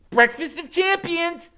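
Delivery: high, loud, slow toast; high giddy woman. A narrator's reading. Loretta toasting Iggy, high and giddy. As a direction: raise the can and sing it out.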